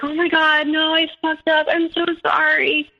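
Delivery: high, pleading mock apology; panicked apology. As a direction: imitate a boyfriend begging forgiveness. Boyfriend caught, apologising.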